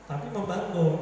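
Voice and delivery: usual speaking voice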